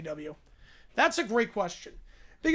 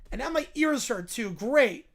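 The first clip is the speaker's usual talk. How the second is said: ordinary talk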